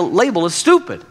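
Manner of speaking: ordinary delivery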